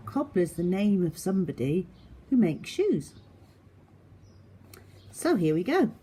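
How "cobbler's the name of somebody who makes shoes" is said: ordinary delivery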